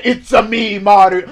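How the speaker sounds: loud mock Mario catchphrase; Mario impression. Arguing that no Italian says that, mock Mario voice. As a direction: lightly put on Mario's voice, loudly. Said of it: "No Italian say".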